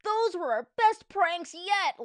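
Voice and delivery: put-on high, loud, delighted boy; drawn-out, high-pitched delight; gleeful Harold. A narrator's reading. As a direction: crow over it with glee. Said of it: "laughed Harold".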